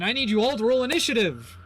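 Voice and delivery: fast, loud, rising call; excited announcement. A narrator's reading. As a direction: announce the fight with energy. GM calls initiative.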